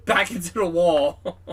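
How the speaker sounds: laughing through the words; real laughing. Real, not acted. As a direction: crack up while finishing the sentence. Narrator cracks up at the yeet.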